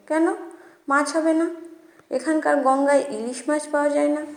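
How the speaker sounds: innocent. A narrator's reading. Jojo still asking the manager about fish, innocently. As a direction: ask guilelessly, as if missing the irritation. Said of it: "তবু সরলভাবে বলল".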